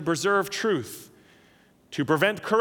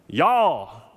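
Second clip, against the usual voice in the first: drawn-out Southern y'all, louder; 4 dB louder than usual. Shown as a demonstration. Pastor teaching the church, Southern drawl. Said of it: "Second person plural would be".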